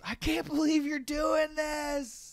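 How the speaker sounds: very high, loud, slow excited shout. Acted. Prince greeting Raz before the race, very high thrilled shout. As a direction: burst out in disbelief and delight.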